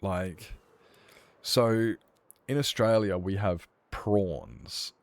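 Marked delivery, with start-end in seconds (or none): 3.2-4.9 slow emphasis. Shown as a demonstration. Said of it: "Like, P R A".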